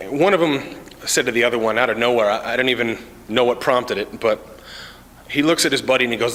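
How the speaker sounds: ordinary talk, nothing marked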